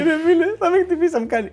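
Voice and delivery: very high, frozen-in-fear imitation; mock frightened friend. Stiff friend fears the cop will hit him, high mock fright. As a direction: squeal it under your breath like you dare not move. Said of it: "ήταν έτσι ακίνητος και μου λέει".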